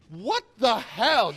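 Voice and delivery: sudden loud exasperation, drawn out; exasperated outburst. Acted. Suitor to the woman, an exasperated loud outburst. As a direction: blurt it out, fed up and loud.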